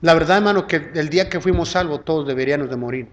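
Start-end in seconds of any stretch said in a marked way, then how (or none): none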